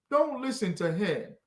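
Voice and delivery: usual speaking voice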